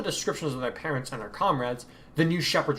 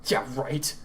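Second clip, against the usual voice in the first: ordinary delivery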